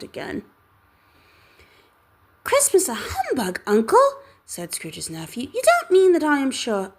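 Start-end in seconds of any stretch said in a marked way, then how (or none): 2.4-4.1 high, amused disbelief; incredulous nephew. Acted. Nephew's surprised retort. 5.5-6.9 high, louder playful coaxing; coaxing nephew. Acted.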